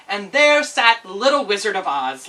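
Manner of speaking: plain reading voice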